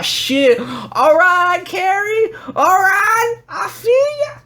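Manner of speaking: very high, shouted mock excitement; hammed-up falsetto whooping; hyped approval. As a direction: cheer them on in a stretched squeal. Acts out hyped reply to girl.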